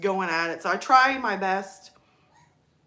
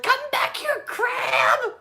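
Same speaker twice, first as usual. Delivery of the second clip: high mock shout, drawn out on crab; son's yell; much slower than usual. Son yelling after a fleeing crab, mock high shout. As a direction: imitate a kid hollering, voice shooting up.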